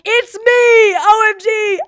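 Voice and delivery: high, loud shout; reading her notes, shrieking with joy; excited shriek. Retold or imitated. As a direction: shriek it in delight.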